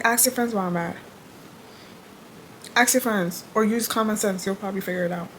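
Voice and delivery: soft, flat over-it reply. Retold or imitated. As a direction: say it like you're done with him. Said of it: "the same tone I'm using".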